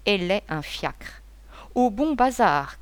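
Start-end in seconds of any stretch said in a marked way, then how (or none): 1.7-2.8 a raised, higher call to the cab driver. Acted. Gacougnol calling out to the coachman, raised voice. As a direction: shout the address up to the driver. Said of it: "cria-t-il".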